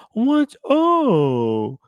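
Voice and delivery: high, loud tipsy hello; drunk greeting. Retold or imitated. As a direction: squeal it like you're already buzzed. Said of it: "she's like".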